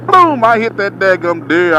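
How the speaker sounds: usual speaking voice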